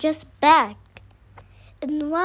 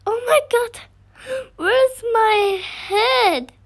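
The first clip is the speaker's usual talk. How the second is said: shocked cry; 6 semitones higher, 6 dB louder than usual. Real, not acted. Gamer finds his avatar headless, loud shocked cry. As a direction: shout it out in sudden alarm.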